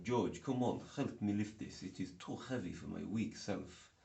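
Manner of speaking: put-on Russian accent; playful fake Russian accent. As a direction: plead for help in a mock Russian accent. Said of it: "like Russian accent".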